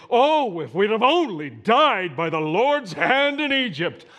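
overdone wail of regret; melodramatic whining. Retold or imitated. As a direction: ham up the self-pity. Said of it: "it is very melodramatic".

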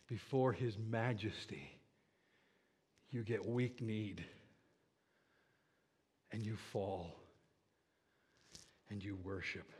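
low, soft, with long pauses; reverent hush. Acted. Preacher describes falling in worship before majesty, reverent hush. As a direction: let each phrase sink into silence.

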